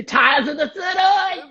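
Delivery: high, slow, exaggerated Australian accent with a laugh; imitating Australian accent. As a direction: mimic a friend's Australian accent, hammed up. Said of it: "I love the way you say".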